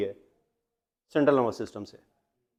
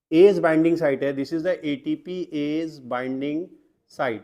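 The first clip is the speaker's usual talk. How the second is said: slow dictation; 3 semitones lower, much slower than usual. Shown as a demonstration. Teacher dictates key term slowly.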